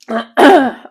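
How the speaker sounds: loud rough throat clear. Real, not acted.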